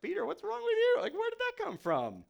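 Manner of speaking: high, squeaky disbelief; incredulous. Retold or imitated. The crowd reacting to Peter, squeaky and incredulous. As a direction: gasp at something absurd.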